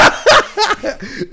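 a real, helpless laugh; giggling fit; high laughing burst. Real, not acted. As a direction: laugh breaking out high and loud. Laughs again at the joke.

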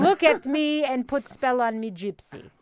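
slow Russian-accented mock scolding; Albanian mom character. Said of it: "can we get a little bit of that".

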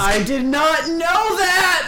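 high, loud disbelief; shocked exclamation. Real, not acted. Friend learning he was barely held on, shocked shout. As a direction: burst out, astonished, rising in pitch.

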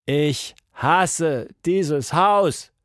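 drawn-out sulky complaint; grumbling. A narrator's reading. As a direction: grumble it out word by word. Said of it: "grummelte sie".

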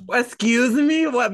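loud, high-pitched disbelief; incredulous. Real, not acted. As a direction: challenge it, voice shooting up.

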